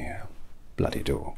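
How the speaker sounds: ordinary delivery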